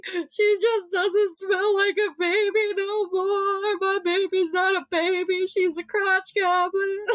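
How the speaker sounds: high, wailing mock cry; hammed-up tearful whine, half laughing; mock sob. Mom retelling her sniffing meltdown, high mock sobbing. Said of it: "And I was like".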